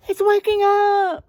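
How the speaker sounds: high panicked shout; animals shouting. Acted. Frightened forest animals shouting the alarm. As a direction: yell it out in alarm. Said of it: "shouted the animals".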